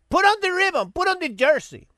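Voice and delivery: high, mocking imitation of a pushy advocate; sing-song mock of someone nagging; mocking nag.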